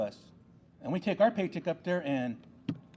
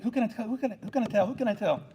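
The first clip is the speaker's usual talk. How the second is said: eager to share; 6 semitones higher, faster than usual. Retold or imitated. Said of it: "I was like".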